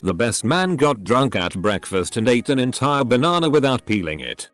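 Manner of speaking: ordinary delivery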